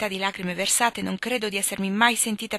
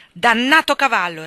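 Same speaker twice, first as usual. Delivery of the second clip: loud burst against the horse; angry curse; 4 semitones higher, 11 dB louder than usual. Acted. Husband curses the sick horse to the butler, loud anger. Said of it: "replicò".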